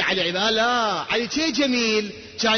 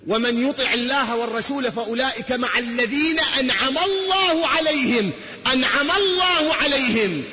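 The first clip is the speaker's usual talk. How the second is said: high, slow drawn-out verse; slow soaring Quran recital; slow fervent recital; 3 semitones higher, slower than usual. Acted. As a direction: stretch each word out for the crowd.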